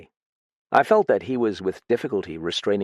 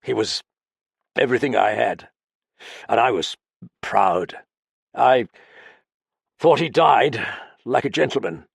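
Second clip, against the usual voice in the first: halting, broken phrases, raised and louder; hesitant; 3 semitones higher, 4 dB louder than usual. Acted. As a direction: falter between words. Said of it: "he said hesitantly".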